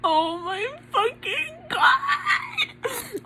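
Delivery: very high, drawn-out tearful squeal; strangled squeal of real joy; held-back scream. Real, not acted. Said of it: "I have to scream and I can't".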